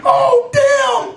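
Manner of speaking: high-pitched mock alarm; alarmed exclamation. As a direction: yelp it in comic dismay. Acted alarm at what he found.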